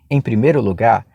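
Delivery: ordinary talk, nothing marked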